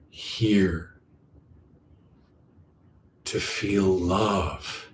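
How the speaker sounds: slow, drawn-out meditative phrasing; guided meditation. Acted. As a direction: linger on each word, letting it land. Slow meditation teaching, lingering words.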